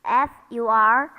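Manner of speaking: letters F U R spelled out; spelling out. Shown as a demonstration. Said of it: "like fur".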